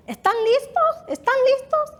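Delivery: high, loud calling mother in Spanish. Retold or imitated. Mother to her children, "¿Están listos?" called out loud. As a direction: call through the apartment to rouse the kids. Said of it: "I could hear my mother saying".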